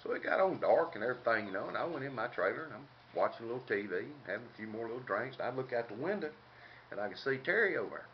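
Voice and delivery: ordinary delivery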